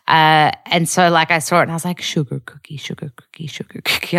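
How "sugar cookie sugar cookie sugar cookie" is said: put-on whispered American accent, "sugar cookie" repeated; practicing American accent. Practicing the trigger phrase on seeing the cookie, whispered American accent. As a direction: murmur it to yourself with an American R. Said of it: "practicing my American accent".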